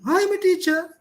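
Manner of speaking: high, doubtful "I am a teacher"; unconfident. Shown as a demonstration. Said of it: "He doesn't have confidence on himself".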